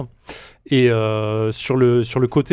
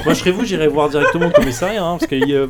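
put-on Belgian accent; 4 semitones higher, 3 dB louder, faster than usual. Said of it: "un peu belge quand même".

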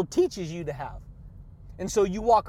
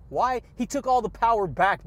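ordinary talk

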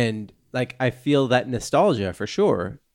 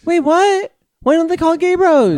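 high squeal; 5 dB louder than usual. Acted.

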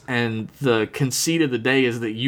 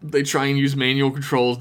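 ordinary talk

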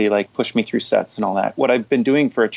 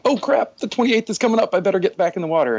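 much higher, rushed sudden alarm; mock panic; 8 semitones higher, faster than usual. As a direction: quote a sudden "oh no" moment quickly. Retold sudden realization.

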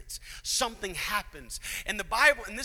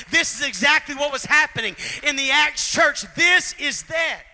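loud, high preacher's cry; preaching at full force; 4 semitones higher, 12 dB louder than usual. Real, not acted. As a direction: drive the point home to the crowd.

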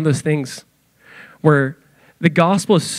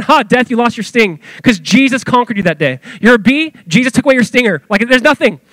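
higher, cocky mock challenge; taunting death; 6 semitones higher, 3 dB louder than usual. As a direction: jeer at death like a beaten bully. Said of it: "taunt death with the same spirit".

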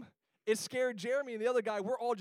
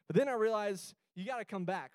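ordinary delivery

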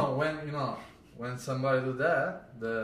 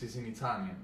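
ordinary talk, nothing marked

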